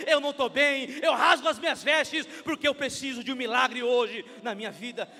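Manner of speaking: loud, impassioned acted confession; full-force pleading from the pulpit; crying out to God. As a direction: shout the admission as a desperate prayer.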